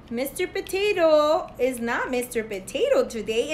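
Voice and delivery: usual speaking voice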